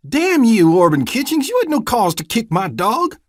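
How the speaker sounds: very loud, high furious cry; outraged shout. Acted. Vernon yelling at the deputy for kicking his dog. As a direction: scream at the man who hurt his dog.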